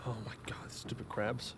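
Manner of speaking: hushed, muttered curse under breath; exasperated whisper to herself; annoyed whisper. Real, not acted. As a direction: grumble it almost silently. Annoyed by crabs in game.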